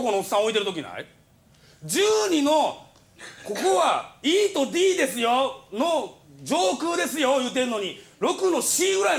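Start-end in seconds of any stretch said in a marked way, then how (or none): 1.8-7.4 slow insistent protest. Retold or imitated. Insisting on his seat area.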